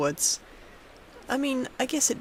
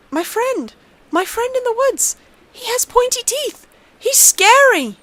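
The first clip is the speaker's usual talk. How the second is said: put-on much higher, louder, frantic girl; terrified child; 14 semitones higher, 9 dB louder than usual. A narrator's reading. Girl tells her father about the toothed man, terrified. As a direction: panic rising to a scared cry.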